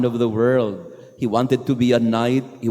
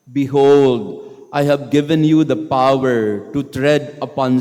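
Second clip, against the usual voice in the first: solemn, raised reading voice for the Gospel; Gospel proclamation; 3 semitones higher, 4 dB louder than usual. Acted. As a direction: proclaim it to the church, full and measured.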